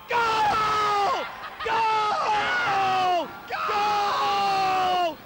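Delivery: loud, high ecstatic goal shouts; repeated ecstatic shouts of Goal; goal call. Real, not acted. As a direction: roar it out like the crowd just erupted. Commentator celebrating a goal.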